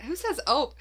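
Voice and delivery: high, mock-incredulous question; retold disbelief. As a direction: scoff playfully that anyone says it. Retold disbelief at reading post.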